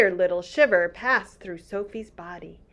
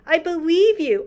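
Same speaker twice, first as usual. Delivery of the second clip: cried out; 10 semitones higher, 9 dB louder than usual. Acted. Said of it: "Sophie cried".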